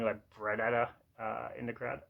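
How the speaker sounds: ordinary talk, nothing marked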